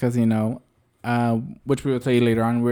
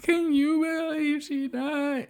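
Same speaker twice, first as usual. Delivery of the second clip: high, soft, slow weepy whine; mock crying passenger; 14 semitones higher, 8 dB quieter, much slower than usual. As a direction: mimic him wailing at the driver. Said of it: "he was talking to me".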